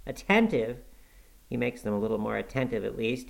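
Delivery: ordinary delivery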